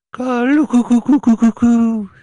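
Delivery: high drawn-out coo-coo bird call; imitated cuckoo call. Proving it's Dave Thomas with his call, high cooing. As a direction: hoot a run of high coos.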